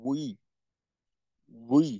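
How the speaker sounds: উই said slowly and clearly as a teaching model; wi demo. Shown as a demonstration. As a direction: sound out উই for the students. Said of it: "জেরের উচ্চারণ".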